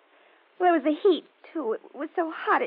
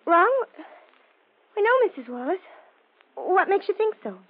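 high, raised, over-casual questioning; feigned innocence; 4 semitones higher, 3 dB louder than usual. Acted. Daughter deflecting a neighbour's worry, innocent. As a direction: act puzzled, pitch up.

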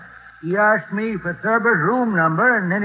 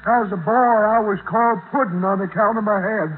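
dim-witted yokel. Acted. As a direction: play the dim country boy. Slow-witted yokel character.

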